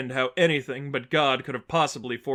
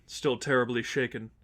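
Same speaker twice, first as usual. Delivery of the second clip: plain reading voice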